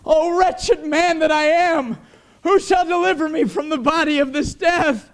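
high, loud, slowed anguished lament; Paul crying out. Acted. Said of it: "Paul cried out".

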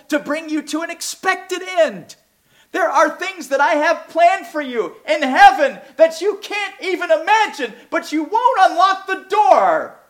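high, rising appeal that builds in intensity; God's voice, yearning and urgent; voice of God pleading. Acted. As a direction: plead as the Lord longing to bless. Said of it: "he's saying".

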